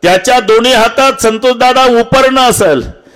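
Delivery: high-pitched, insistent kirtan oratory; impassioned preaching. Real, not acted. Preacher showing the congregation a father weeping alone, impassioned. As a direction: build the picture in mounting, pleading phrases.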